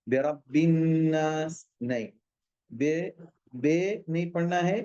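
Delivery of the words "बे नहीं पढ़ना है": ordinary talk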